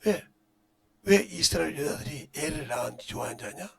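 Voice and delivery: ordinary talk, nothing marked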